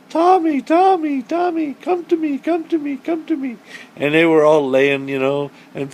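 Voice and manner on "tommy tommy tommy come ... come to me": coaxing a pet. Retold or imitated. Mother coaxing the turtle to come to her, sing-song. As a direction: sweet-talk the turtle into swimming over. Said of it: "And I'm like".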